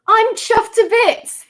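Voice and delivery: high, loud, slowed British catchphrase; Mumbo. Acted. Streamer auditioning with a catchphrase, high loud impression. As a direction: exclaim it as a delighted British character, pitched right up. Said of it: "That's a good Mumbo one".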